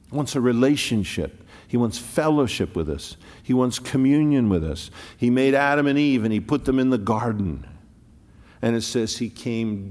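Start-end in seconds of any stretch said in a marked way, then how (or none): 0.1-7.7 rising preaching. Acted. Preaching swell.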